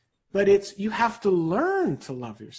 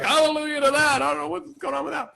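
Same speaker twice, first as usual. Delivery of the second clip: mock exhausted parents; 6 semitones higher than usual. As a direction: play fed-up new parents praising relief. Parents of newborns voiced.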